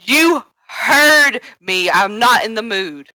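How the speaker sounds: slow, high, emphatic telling-off; stern retort. Acted. Answering Slyra's complaint about tone, stern retort. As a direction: draw out each word as a warning. Acted quarrel over tone.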